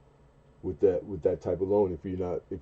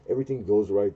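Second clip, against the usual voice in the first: ordinary talk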